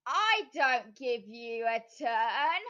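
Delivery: slow, loud laughing witch. A narrator's reading. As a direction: laugh the words out mockingly. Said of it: "laughed the witch".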